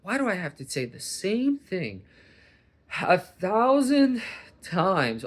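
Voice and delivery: high, stretched out "thousand" in disbelief; retold frustration at the dogs; exasperated. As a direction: sound fed up with repeating yourself. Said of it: "I wondered".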